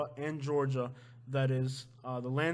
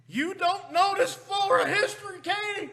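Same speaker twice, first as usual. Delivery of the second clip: high, mock-outraged shout; mock outrage; 7 dB louder than usual. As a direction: shout in exaggerated disbelief at your partner. Mock outrage at partner's ignorance.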